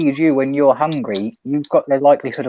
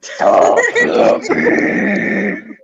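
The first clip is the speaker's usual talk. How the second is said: witch voice; much slower than usual. Pretend. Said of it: "my well witch voice".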